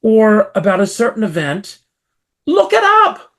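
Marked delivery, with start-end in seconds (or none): 2.5-3.3 indignant outburst. Real, not acted. Indignant plea to research.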